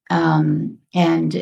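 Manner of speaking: ordinary talk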